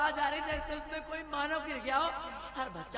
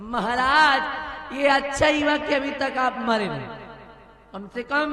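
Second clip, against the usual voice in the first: loud gloating; 7 dB louder than usual. Acted. Villain queen gloats at dying king.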